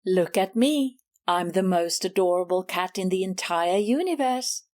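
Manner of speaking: lightly voiced Miss Maggie, purring her own praises; smug rival cat. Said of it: "let me tell you".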